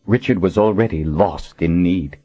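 ordinary delivery

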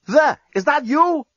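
high-pitched call down the row; calling from afar. A narrator's reading. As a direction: call out from a distant cell. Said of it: "Lucy's voice came from the cell down the row".

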